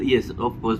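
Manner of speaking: normal talking voice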